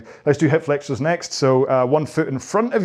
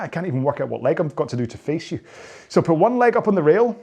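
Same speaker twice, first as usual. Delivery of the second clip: ordinary talk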